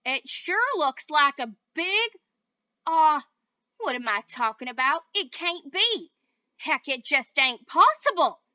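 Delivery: loud drawling cowboy, baffled; cowboy disbelief. A narrator's reading.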